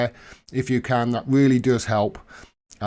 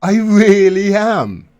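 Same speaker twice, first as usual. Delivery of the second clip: louder, higher, slow emphatic yes; loud insisting; 6 semitones higher, 9 dB louder, much slower than usual. Retold or imitated. Host retelling insisting to girlfriend, slow loud yes. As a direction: dig in, stretch it out loudly. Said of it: "I said".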